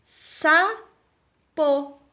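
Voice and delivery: sapo as sa, pause, po; split into syllables. Shown as a demonstration. Teacher counting two syllables, word split apart. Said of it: "duas sílabas".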